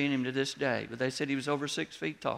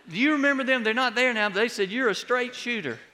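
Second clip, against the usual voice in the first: high and louder, eager recollection; a man delighted to recognise him; excited stranger; 10 semitones higher, 6 dB louder than usual. Retold or imitated. Man recalling pastor excitedly.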